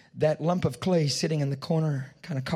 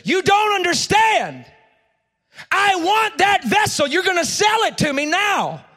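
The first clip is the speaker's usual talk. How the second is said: agitated shout; 9 dB louder than usual. A narrator's reading. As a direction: bellow it at the potter, demanding.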